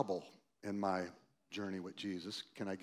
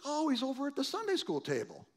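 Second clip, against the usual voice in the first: mocking; 5 dB louder than usual. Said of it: "and it was like".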